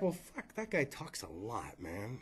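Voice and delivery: imitating grumbling guest. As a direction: grumble it under your breath like a tired guest driving home. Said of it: "they're just like".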